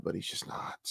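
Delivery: hushed whisper to yourself. Real, not acted. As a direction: breathe it as a soft aside. Aside about Osmongold.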